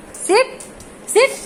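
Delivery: high, softish staccato command; short rising orders, pitched well up; dog command. Real, not acted. Owner ordering the dog to sit, high short command.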